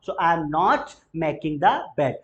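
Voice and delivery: spoken very slowly, word by word; slow model sentence. Shown as a demonstration. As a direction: lay out each word slowly for learners. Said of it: "no में बोलना हो". (बहुत धीरे, शब्द-शब्द करके)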